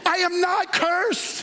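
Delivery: high, emphatic declaration, drawn out; like you mean it. Shown as a demonstration. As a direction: proclaim it with full conviction. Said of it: "say it like you mean it".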